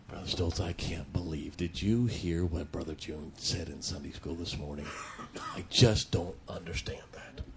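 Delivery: low, hushed grumbling under the breath; gossipy murmur. Shown as a demonstration. Preacher mimicking a churchgoer's hushed, gossipy complaint. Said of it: "under your breath or very quietly".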